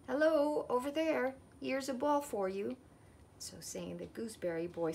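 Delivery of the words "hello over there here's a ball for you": loud, high call across the garden. A narrator's reading. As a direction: hail someone over there and toss the words out. Boy calls to others while throwing ball.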